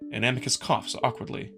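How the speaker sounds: usual speaking voice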